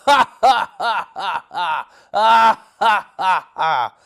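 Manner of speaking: loud, high booming mock laugh; hammed-up villain laugh; terrible giant laugh. Goliath laughing at the army, loud hammed-up laugh. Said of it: "he laughed his terrible laugh".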